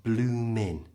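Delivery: bloomin' with no G, stopping on the N; silent G. Shown as a demonstration. Teacher showing bloomin' without the G. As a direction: say bloomin' ending on the N sound. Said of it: "The G is silent".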